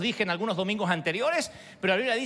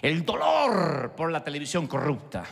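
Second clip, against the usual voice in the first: ordinary delivery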